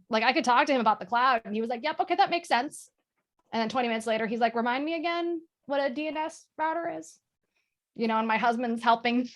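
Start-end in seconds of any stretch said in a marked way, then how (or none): 1.8-2.9 imitated dad agreeing. Voices dad following along. 4.5-7.2 imitated puzzled dad. Voices dad asking again, confused.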